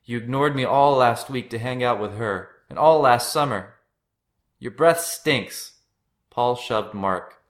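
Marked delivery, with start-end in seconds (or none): 0.0-5.8 loud, higher-pitched accusation; heated sibling outburst; angry yelling. A narrator's reading. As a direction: keep hurling accusations at a brother. Paul keeps yelling at his brother.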